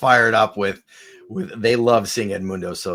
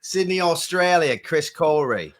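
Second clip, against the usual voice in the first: higher-pitched mock Aussie shout-out; rusty Australian accent; 6 semitones higher, slower than usual. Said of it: "try to break out my Australian accent".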